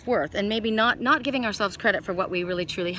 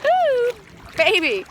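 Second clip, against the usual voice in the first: a loud, high whoop of delight; excited whoop; 12 semitones higher, 7 dB louder, much slower than usual. Real, not acted. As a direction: shriek with joy at the cold water. Jumping into the water.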